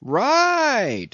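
high, pleased cry; a mate egging on the preaching cook; approving exclamation. Acted. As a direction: cheer him on. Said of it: "exclaimed Stubb approvingly".

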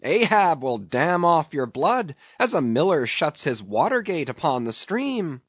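high, exultant sailor's shouting; high-pitched, fired-up calling out; taunting cry. A narrator's reading. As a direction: hurl the taunt at the fleeing whale. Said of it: "cried Stubb".

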